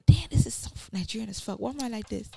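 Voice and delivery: hushed whisper to herself. Retold or imitated. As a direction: whisper it in disbelief. Quoted inner thought.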